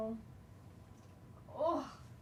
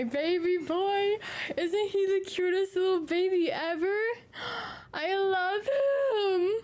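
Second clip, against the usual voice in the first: higher, slow, adoring gush with a catch; gushing over pet; 6 semitones higher, slower than usual. Real, not acted. Showing her cat to viewers, she gushes adoringly. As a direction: melt over how cute he is.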